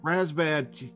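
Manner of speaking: ordinary delivery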